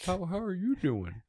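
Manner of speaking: high, soft pickup line; flirty bear voice. Pretend. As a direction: coo it like a bear hitting on someone. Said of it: "that bear is like".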